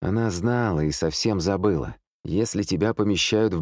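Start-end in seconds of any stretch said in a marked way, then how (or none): none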